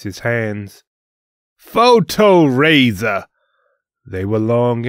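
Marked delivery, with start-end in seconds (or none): 1.6-3.3 slow, loud, high battle cry; loud spell shout. Acted. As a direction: drag out the attack name. Otis casting a spell.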